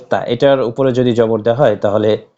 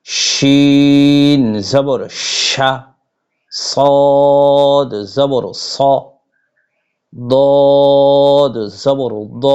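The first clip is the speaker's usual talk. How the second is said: slow Arabic letter drill; much slower than usual. Shown as a demonstration. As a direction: recite each letter slowly like a Quran teacher. Leads a recitation drill. (ধীরে টেনে টেনে আরবি হরফ পাঠ)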